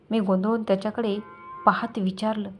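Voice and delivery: plain reading voice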